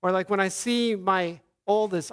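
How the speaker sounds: ordinary talk, nothing marked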